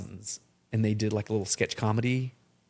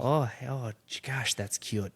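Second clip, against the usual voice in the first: slow, softer Minnesota drawn-out vowels; Minnesota accent; 4 dB quieter, slower than usual. Acted. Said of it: "Minnesota accent".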